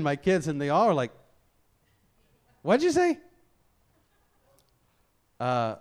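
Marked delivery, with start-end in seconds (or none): 2.6-3.2 high-pitched, louder mock disbelief; incredulous. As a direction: imitate a family pouncing on a slipped word. Family reacting to slipped word.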